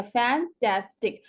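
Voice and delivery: Fantastic broken slowly into three syllables; split into syllables. Shown as a demonstration. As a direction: say fantastic in separate chunks, fan-tas-tic. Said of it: "हमने कैसे divide किया".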